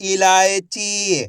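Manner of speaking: इलायची very slow, long chee at the end; blended word demo. Shown as a demonstration. Teacher joining letters for pupils, इलायची drawn out. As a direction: stretch each syllable of इलायची. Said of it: "तो मिलके बन जाएगा".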